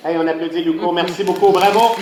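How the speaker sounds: loud, rising showman cheer; rally the crowd to clap. Acted. Calls crowd to applaud.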